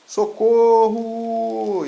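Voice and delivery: long, high, loud drawn-out cry; scream for help. Acted. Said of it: "E ele começa a gritar".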